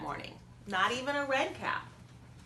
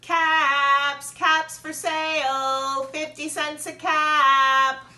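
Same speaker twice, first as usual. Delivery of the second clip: loud, slow street cry up high; drawn-out hawker's cry; peddler calling out; 7 semitones higher, 10 dB louder, much slower than usual. A narrator's reading. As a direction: call out your wares to the whole street.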